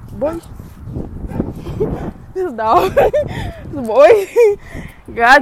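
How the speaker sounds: laughing through the words, pitch jumping up; real laughter bubbling through. Real, not acted. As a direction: crack up at the dog while talking. Laughing at the dog.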